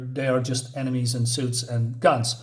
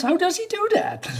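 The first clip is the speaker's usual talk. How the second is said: much higher, squeaky French-free goon voice in disbelief; stunned enemy. Acted. The enemy gasping at the hero's trick, much higher astonished voice. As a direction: play the baffled henchman, pitch shot way up.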